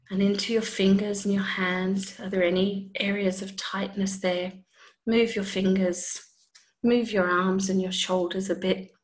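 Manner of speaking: calm body scan voice; guided meditation. Acted.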